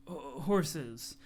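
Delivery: ordinary talk, nothing marked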